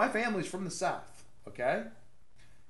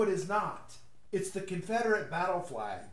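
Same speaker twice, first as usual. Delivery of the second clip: normal talking voice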